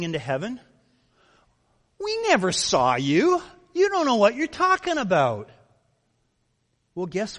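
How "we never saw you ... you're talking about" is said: much higher, louder outraged denial; indignant protest. Retold or imitated. The self-righteous protesting to the Lord, loud, high and indignant. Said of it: "imagine the tone of the people".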